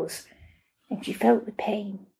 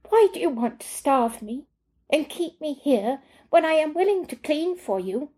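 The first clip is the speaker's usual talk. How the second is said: Marcella pleading; 7 semitones higher than usual. A narrator's reading.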